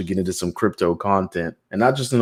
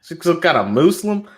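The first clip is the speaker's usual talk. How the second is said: higher-pitched put-on Texan accent; mock Texan; 6 semitones higher than usual. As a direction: play a suspicious Texan, hammed up. Mocking a Texan's suspicion of Obama.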